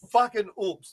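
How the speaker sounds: ordinary talk, nothing marked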